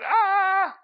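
high, loud mock scream of terror; terrified yelp. Preacher re-enacting his shock as his hand lands on her knee, terrified yelp. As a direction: let out a comic shriek of panic. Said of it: "Because I'm terrified".

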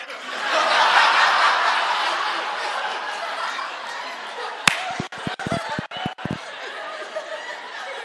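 long airy high laugh. Real, not acted. Preacher cracking up at his own punchline, long laugh. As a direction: let the laugh roll out.

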